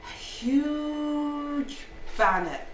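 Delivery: "huge" stretched long and high; drawn-out HUGE. A narrator's reading. Storyteller showing the bannock's size, drawn-out HUGE.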